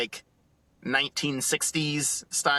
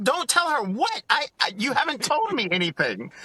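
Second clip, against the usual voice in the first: high, baffled protest; confused exasperation; 10 semitones higher than usual. Retold or imitated. Husband answering his wife's cryptic text, high baffled protest. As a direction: squeak it out, totally lost. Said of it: "I was like".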